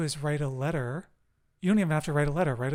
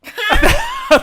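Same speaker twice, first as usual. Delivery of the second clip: loud burst of laughter; 16 dB louder than usual. Real, not acted. Laughing at the fake accent.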